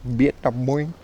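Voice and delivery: Vietnam said as "Biet-naung" in a Vietnamese accent; lightly put-on Vietnamese accent on Vietnam. Shown as a demonstration. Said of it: "You can do that".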